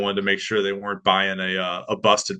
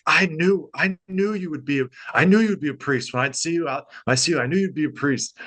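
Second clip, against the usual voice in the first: delighted groundskeeper; 6 semitones higher than usual. Retold or imitated.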